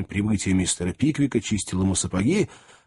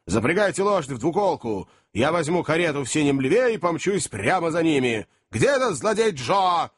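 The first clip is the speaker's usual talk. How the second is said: high, urgent shouted orders; exclaiming; 7 semitones higher than usual. A narrator's reading. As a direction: fire off commands, rising to a shout. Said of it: "воскликнул он".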